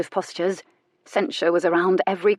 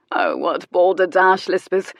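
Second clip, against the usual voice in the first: slow, yawning put-down; bored drawl, stretched out; yawned dismissal; 4 dB louder than usual. A narrator's reading. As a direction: say it through a lazy yawn. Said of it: "Jonathan yawned".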